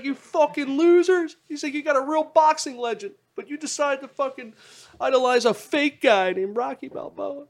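high, loud mock ranting comedian; Bill Burr rant.

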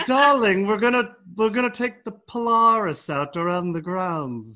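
grand upper-class estate voice; posh aristocrat. Pretend. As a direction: play lady of the manor, high. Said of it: "you gotta live at Downton Abbey".

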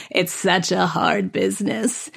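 slow, drawn-out fake sympathy; mock pitying. Restaurant customer pitying an actress waiting tables, slow mock sympathy. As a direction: imitate a doubtful diner, dragging each word. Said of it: "give me the side-to-side head shake".

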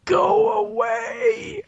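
strained, high, drawn-out croak. Shown as a demonstration. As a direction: force it out through a tight throat. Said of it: "Can you recreate it for us, the croak?".

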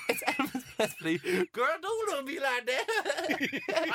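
laughing through the words. Real, not acted. Laughing at his own mistake.

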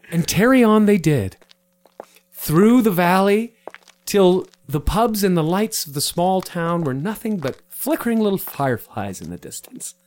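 grand, hammed-up storybook narration; mock-epic narrator; story narration. A narrator's reading. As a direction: narrate the quest like an epic tale. Narrates the knights' journey.

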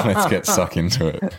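laughing through the words; breaking into a laugh mid-line. Real, not acted. Laughs while restarting the line.

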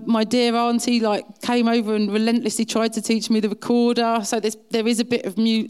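ordinary talk

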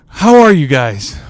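loud, drawn-out sighing greeting. Real, not acted. Podcaster greeting listeners after a long week, sighing. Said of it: "look at that sigh".

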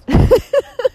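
high loud burst of laughter; squealing laugh. Real, not acted. After cursing the free-gig askers, a high loud laugh. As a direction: laugh off the frustration.

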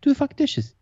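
high mock yell at the bored kid; mock fed-up grandfather; exasperated grandfather. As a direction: snap it at the kid. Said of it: "He's like".

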